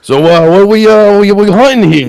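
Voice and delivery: cowboy streamer asking where they hunt, loud and twangy; hammed-up Southern cowboy. Cowboy voice, louder, higher.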